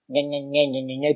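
mimicking a child's petty whining; mock nyah-nyah, under the main voice; childish nagging. Reader mimicking the narrator's childish argument, childish nagging. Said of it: "o argumento dela é tipo assim".

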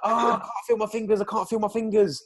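high, strained cry of pain; mate in pain after the kite yank, panicky. Retold or imitated. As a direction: yelp it out, hurting. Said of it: "He's like".

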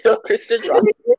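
very high squealing disbelief; shocked. Pretend. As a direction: shriek it in disbelief. Incredulous squeal.

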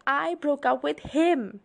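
high, loud, slow shout; shouting back. A narrator's reading. Bella answers her father while zipping her bag, shouting. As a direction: shout it back, stretching the words. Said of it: "I shouted back".

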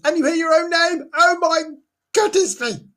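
mock fright. Imagining hearing own name.

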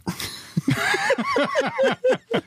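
high-pitched laugh at himself; a squeaky laugh at his own failure. Real, not acted. Laughing at his poor tournament average, high laugh.

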